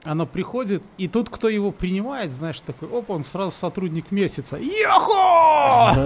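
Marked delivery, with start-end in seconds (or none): 4.6-5.9 high, long drawn-out cheer; excited whoop. Acted. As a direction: whoop with joy.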